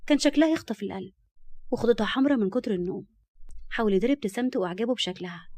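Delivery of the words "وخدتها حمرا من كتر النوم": plain reading voice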